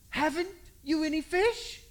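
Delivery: high, slow calling voice; Jesus on the bank calls to the fishermen, high and slow; stranger calling out. Retold or imitated. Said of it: "he yells to him".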